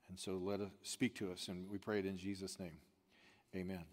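soft, hushed pastoral prayer; quiet prayer. Acted. Opening prayer before the sermon.

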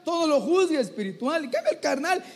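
normal talking voice

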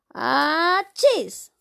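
loud high fake sneeze; a big acted sneeze. Martina sneezes.

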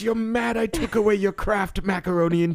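high-pitched pleading doctor; Doctor Loomis voice. Retold or imitated. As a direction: beg him like an exasperated doctor. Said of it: "Doctor Loomis suddenly made an appearance".

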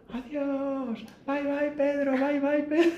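voces muy agudas y cantarinas; despídete como fan encandilada; chicas despidiéndose. Retold or imitated. (recepcionistas imitadas con voz aguda y melosa)

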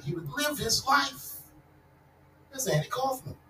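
ordinary talk, nothing marked

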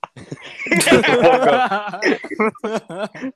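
high, loud laughing fit; loud burst of laughter. Real, not acted.

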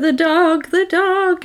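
high-pitched mock panic; distraught. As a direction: imitate her frantically crying out for the pet. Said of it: "she's distraught because she was like".

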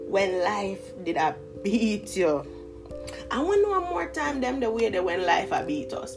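ordinary talk, nothing marked

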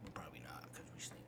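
soft resigned whisper. Real, not acted. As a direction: concede it under your breath. Deflated joke about team.

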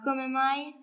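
ordinary delivery

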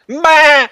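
loud high bleat "Ma!"; goat bleat. Acted. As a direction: let out a big goat bleat. Goat man bleats his name.

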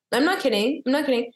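ordinary talk, nothing marked

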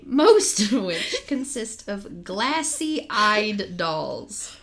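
loud, very slow, laughing through it. Real, not acted. Rereading the line, still laughing hard. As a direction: push the words out through laughter.

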